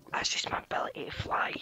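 soft, voiceless whisper; playful whisper. Pretend. Gamer excusing himself from cheating, whispered. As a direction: whisper it like a secret power. Mock-mysterious answer to cheating charge.

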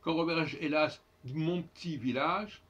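"mon petit" as "mon p'tit", no e; dropped schwas. Shown as a demonstration. Teacher rereading Du Bellay's line his way, dropped schwas. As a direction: run "petit" into one syllable, "p'tit". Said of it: "une apostrophe".

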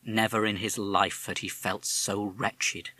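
ordinary delivery

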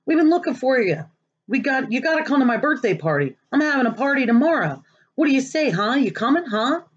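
tumbling over words to invite him; eager begging kid. Acted. Kids begging him to party.

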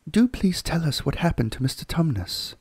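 put-on young girl begging for the story; Lucy's voice. Lucy asking Mr Beaver, eager girl voice.

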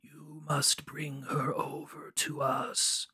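put-on slow, drawn-out, whispery old man; whispery old man, words stretched out. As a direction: impersonate an aged father, slowly and half whispered. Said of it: "it's the old man voice".

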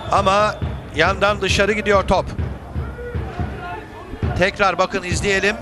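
ordinary talk

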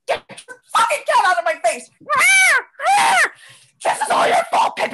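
furious rasped yell. Acted. Yelling at the cat.

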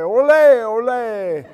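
a hammed-up olé from the crowd; mock yell. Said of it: "it seemed like something to yell".

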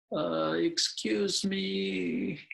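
slow, timid rasp; raspy. Acted. Bluebird timidly addressing the scops owl, raspy and slow. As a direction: ask hoarsely, barely daring. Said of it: "In a raspy voice".

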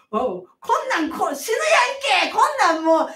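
loud, high, rough mother in labour; rough furious yelling. Retold or imitated. Mother in labour yelling at midwife, rough furious shout. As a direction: bellow it like a thug. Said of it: "って言われて".

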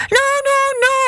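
high, panicked rabbit crying out; desperate cry. A narrator's reading. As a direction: cry out as he's being left behind. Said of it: "cried the rabbit".

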